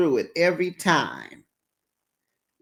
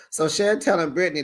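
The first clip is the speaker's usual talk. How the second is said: usual speaking voice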